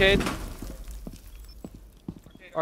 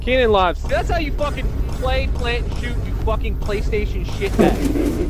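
hyped trash talk; 5 semitones higher, 5 dB louder, slower than usual. Real, not acted. Gloating after a kill.

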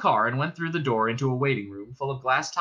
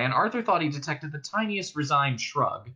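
ordinary delivery

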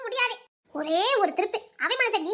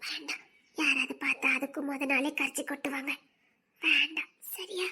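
whispered plea; 6 semitones lower, 5 dB quieter than usual. Acted. Whispering to him not to walk her, fearing gossip. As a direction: breathe it so no one overhears.

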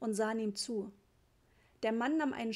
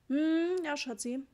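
long high hum, then falling off; humouring assent; 5 semitones higher, 3 dB louder, slower than usual. A narrator's reading. Father humouring his wife at bedtime, vague assent. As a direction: hum agreement, then trail off.

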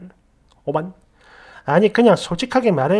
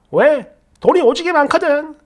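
usual speaking voice

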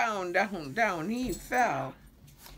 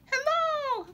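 high, loud call down from a tree; joey calls down, high and loud; 6 dB louder than usual. A narrator's reading. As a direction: call out to friends below. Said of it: "called Roo".

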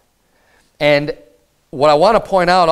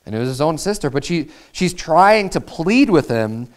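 normal talking voice

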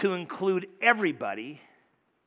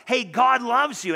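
loud, slow, higher call of good news; 6 semitones higher, 7 dB louder than usual. Pretend. Preacher has the creed call out to the congregation, loud and slow. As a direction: proclaim it as if the creed were shouting. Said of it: "It screams out".

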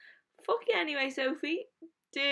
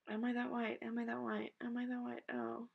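much softer, worried murmur; quiet; 10 dB quieter than usual. Real, not acted. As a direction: mutter it to yourself. Muttering in shock.

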